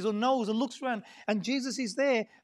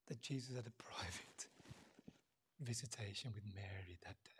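hushed whisper; 12 semitones lower, 19 dB quieter than usual. Real, not acted. Preacher whispering the private visitation to the congregation, hushed. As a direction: drop to a breathy whisper for the private moment.

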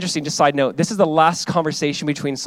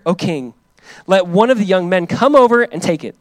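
fervent scripture reading; 2 semitones higher, 6 dB louder than usual. Acted. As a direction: read it out like from the pulpit. Reads David's reply aloud.

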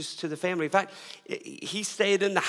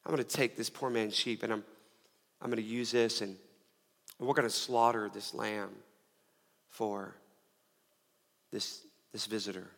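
fading to a hush; 8 semitones lower, 8 dB quieter than usual. Retold or imitated. Rich man's plan retold, fading.

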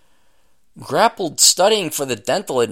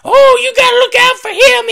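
much higher, loud imitation of a sassy TV nurse; TV wise nurse; 9 dB louder than usual. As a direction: ham up the wise-woman nurse from a TV show.

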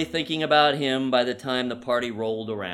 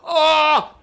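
sudden cry; 12 semitones higher, 11 dB louder than usual. Retold or imitated. Finally dislodging the pick.

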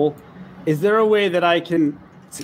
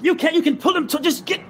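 raised, excited coaching voice; higher-pitched, eager encouragement; urgent urging; 10 semitones higher than usual. Acted. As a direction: urge them on in a rush of excitement. Player urges girl to rescue Max.

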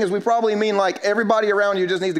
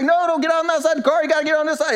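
urgent high-pitched orders, acted out; teacher yelling; 10 semitones higher, faster than usual. Teacher to kid climbing out of the car, yelling. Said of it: "Everybody's yelling at the kid".

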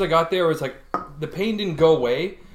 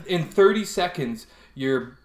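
ordinary delivery

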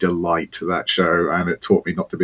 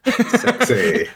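laughing. Real, not acted. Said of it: "laughing remark".

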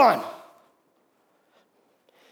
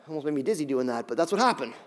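normal talking voice

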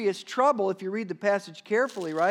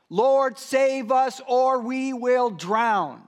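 louder, higher plea stretched slowly at the end; acted desperate cry; 5 semitones higher, 6 dB louder, much slower than usual. Disciples in the storm crying out to Jesus, loud pleading. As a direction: cry out like men about to drown. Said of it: "That's what they said".

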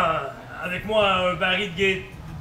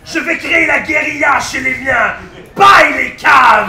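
shouting; 5 semitones higher, 9 dB louder than usual. Acted.

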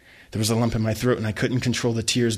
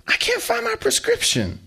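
muttering mom. Acted. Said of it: "she muttered to herself".

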